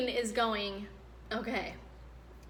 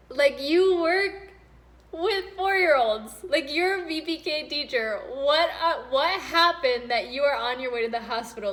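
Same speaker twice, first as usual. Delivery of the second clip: loud disbelief; 4 semitones higher, 7 dB louder than usual. Real, not acted. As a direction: demand answers in stunned disbelief. Shocked wife hearing husband's news.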